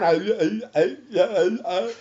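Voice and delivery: speech breaking down into repeated grunts; tongue-tied stammer. Shown as a demonstration. Patient's tongue failing mid-call, garbled stammering. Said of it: "something started to happen to my voice".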